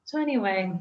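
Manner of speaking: normal talking voice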